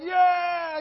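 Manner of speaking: very high, loud shouted yeah; crowd cheer. Retold or imitated. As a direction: whoop like a fired-up mob. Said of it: "And they're like".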